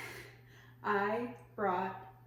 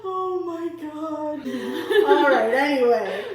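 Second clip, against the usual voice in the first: slow, high, loud words through laughter; loud, drawn-out laughing exhale of words; laughing hard; 6 semitones higher, 11 dB louder, much slower than usual. Real, not acted. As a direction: try to move on while still cracking up. Recovering from a laughing fit.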